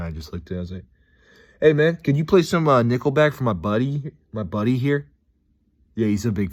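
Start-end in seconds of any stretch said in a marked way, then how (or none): 1.6-5.1 loud, higher prank request; calling out. Retold or imitated. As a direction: pipe up loudly across the room. Calls out in silent sauna.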